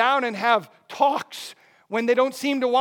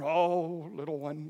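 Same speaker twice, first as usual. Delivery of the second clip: soft, lower, soothing father's voice; tender comforting; 5 semitones lower, 7 dB quieter, slower than usual. Acted. Dad comes over to the struggling child, tender comforting. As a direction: drop low and hush it gently.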